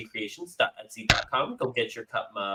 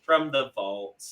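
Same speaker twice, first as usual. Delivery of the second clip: usual speaking voice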